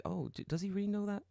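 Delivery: high, soft sceptical question; imitated doubt. Guest doubting the kanji wizard legend, high soft doubt. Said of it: "You're like".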